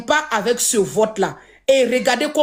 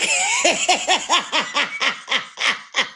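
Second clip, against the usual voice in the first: amused laugh; 2 semitones higher, 3 dB quieter than usual. Real, not acted. Laughs at own English.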